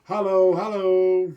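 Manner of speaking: hollow, hollow sung out, rising; drawn-out emphasis. Shown as a demonstration. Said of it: "We have a Hollow".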